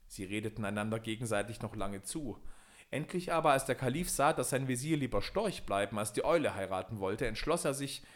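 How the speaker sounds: ordinary delivery, nothing marked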